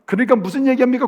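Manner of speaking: normal talking voice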